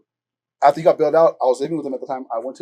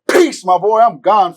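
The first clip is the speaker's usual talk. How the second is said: loud, higher, crowing goodbye; gleeful farewell; 5 semitones higher, 7 dB louder, much slower than usual. Retold or imitated. Released on bail, telling his cellmate goodbye, gleeful. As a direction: gloat on the way out, laughter breaking in.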